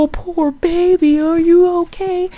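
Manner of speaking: mock-pity a crying baby; hammed-up doting mother; mock coddling mom. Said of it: "she wasn't gonna go".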